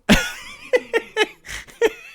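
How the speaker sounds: high, wheezy burst of laughter; laughing. Real, not acted. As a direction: laugh helplessly at your own slip. Laughing at own mic mistake.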